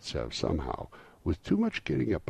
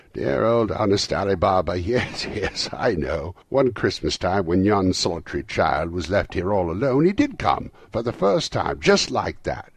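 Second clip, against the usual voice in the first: loud, raised voice bubbling over with delight; ecstatic, half laughing; 5 semitones higher, 9 dB louder than usual. A narrator's reading. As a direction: gush it, between laughing and crying. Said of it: "extraordinary voice between laughing and crying".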